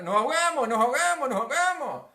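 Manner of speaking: high, loud, frantic repeats; panicked shouting. Acted. Disciples sinking in the storm, panicked shouting. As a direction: cry out in acted alarm, back to back. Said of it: "empezaron a gritar".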